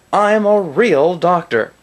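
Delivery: slow, high mock doctor in a commercial; fake doctor. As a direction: pose as a phony TV doctor.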